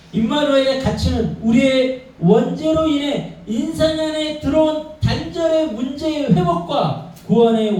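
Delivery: slow high preaching emphasis; emphatic preaching. Real, not acted. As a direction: drive home the key truth. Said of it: "emphatic preaching climax".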